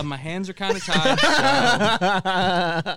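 slow, laughing mock dean. Retelling the dean's excuse to friends, laughing and slow. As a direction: laugh through the dean's shrug-off.

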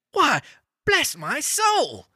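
very high, slow exclamation; amazed, drawn-out high-pitched cry; astonished cry. A narrator's reading. Fred sees his uncle at the door, astonished. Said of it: "cried Fred".

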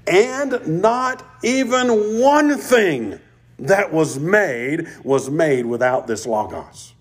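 high, slow stress on not even one; emphatic. Shown as a demonstration. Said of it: "becomes more emphatic".

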